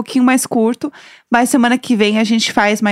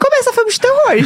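excited exclamation; 12 semitones higher than usual. Real, not acted. Joking horror reaction.

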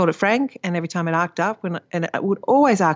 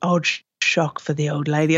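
put-on light Dutch accent. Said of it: "she has a little Dutch accent".

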